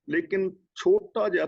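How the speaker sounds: normal talking voice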